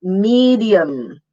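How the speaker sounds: slow "medium", mee-dee-um; careful three-syllable "medium"; long E, D, schwa. Shown as a demonstration. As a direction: draw out "medium" with a clean d. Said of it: "long E and then D and Y".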